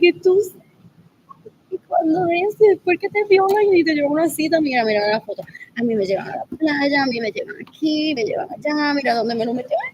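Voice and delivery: high, fast mocking bragging voice; mocking sister. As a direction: imitate her bragging about dates. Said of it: "she's like".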